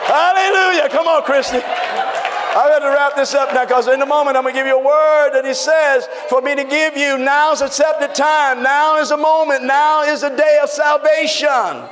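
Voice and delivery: high, loud shouted preaching; preaching at full force. Acted. Pastor rallies the congregation before the word, shouting. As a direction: lift the whole room with it.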